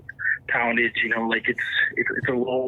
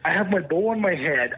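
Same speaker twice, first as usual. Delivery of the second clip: ordinary talk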